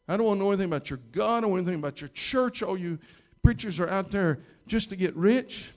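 explosive rant. Retold or imitated. Man railing at the preacher about church, retold explosive rant. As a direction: blast out the accusations in a rush, imitating him. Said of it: "and suddenly he explodes".

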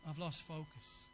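low, soft and slow, as if defeated; dejected low murmur. Retold or imitated. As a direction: sink into a quiet admission of loss. Preacher voicing a tempted person.

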